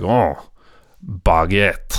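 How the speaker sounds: mock-snooty French pretension, capped with a laugh; stuck up. Host mocking someone attempting French, stuck up. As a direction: show off your "baguette" like a pretentious snob. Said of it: "you sound so stuck up".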